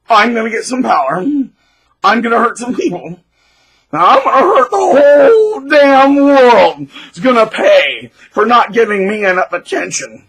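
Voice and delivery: mock power-hungry bully. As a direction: work up to a megalomaniac roar. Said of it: "someone who says".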